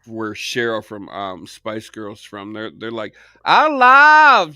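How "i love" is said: loud, high, stretched British vowels; exaggerated British dialect, much higher and louder; drawn-out English dialect. Shown as a demonstration. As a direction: belt it out in a drawn-out regional English accent. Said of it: "really drawn out".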